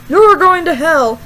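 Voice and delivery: loud, drawn-out pastor's condemnation; slowly and loudly condemn like a hellfire preacher; mock yell. Pastor yelling at girl in a skirt, slow mock yell. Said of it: "He yells".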